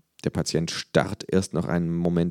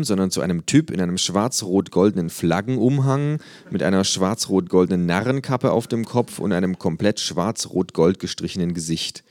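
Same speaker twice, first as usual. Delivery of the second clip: usual speaking voice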